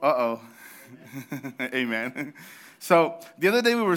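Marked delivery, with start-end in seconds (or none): none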